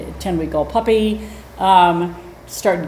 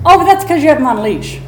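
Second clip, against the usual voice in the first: high, fast and louder brush-off; imitating dismissive woman; 8 semitones higher, 8 dB louder, faster than usual. Storyteller mimicking the woman brushing off the warning, high and fast. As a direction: rattle it off like a know-it-all. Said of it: "and she said".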